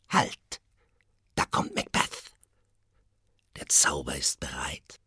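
hushed, secretive witches' whisper. A narrator's reading. Witches whispering as Macbeth approaches, unvoiced. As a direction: breathe it as a conspiracy. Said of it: "säuselten".

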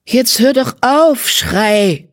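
high, slow, exasperated she-cat; irritated. A narrator's reading. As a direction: tell him off, fed up with his griping. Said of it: "mit einem gereizten Schwanzzucken". (genervt und gereizt, hoch und langsam)

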